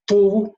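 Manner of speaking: "tu" with a rounded oo vowel; dhamma oo vowel. Shown as a demonstration. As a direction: put the lips together on "tu". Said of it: "put together your lips and it will give".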